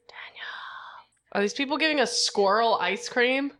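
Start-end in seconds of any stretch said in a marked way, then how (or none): 0.1-1.3 whispered name, breathy and hushed; calling for a friend in a hushed whisper. Real, not acted. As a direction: hiss the name softly into the phone. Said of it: "whisper to phone".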